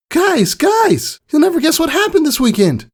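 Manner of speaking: very high, loud, bursting with news; high excited shout; excited filly. Acted. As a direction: blurt it out to her friends. Scootaloo rushing in excited.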